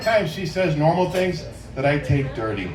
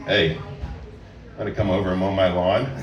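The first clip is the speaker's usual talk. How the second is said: sexy tone; 9 semitones lower, 5 dB quieter than usual. Shown as a demonstration. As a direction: drop the voice low and make it suggestive. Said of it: "make it sound sexy by the tone of your voice".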